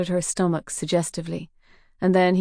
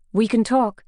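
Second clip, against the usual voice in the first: raised, urgent bargaining; urgent plea; 5 semitones higher, 5 dB louder than usual. A narrator's reading. Captive detective urgently offering to negotiate, raised voice. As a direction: get their attention fast. Katie pleading with captors.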